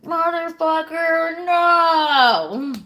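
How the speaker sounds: a slow, disbelieving groan of outrage; exasperated outcry. Retold or imitated. Message from him on WhatsApp, a slow outraged cry.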